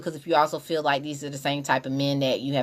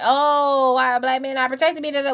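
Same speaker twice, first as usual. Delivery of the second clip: mock complaint; 7 semitones higher than usual. Imitating women who complain about men, high mock complaint. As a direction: act out an aggrieved complaint, pitched up.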